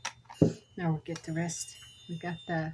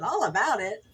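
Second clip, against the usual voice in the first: high, louder mock-puzzled voice; puzzled self quote; 5 semitones higher, 5 dB louder, slower than usual. To husband, reading the sign out loud, high and puzzled. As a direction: play your own confusion at the sign. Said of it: "and I said".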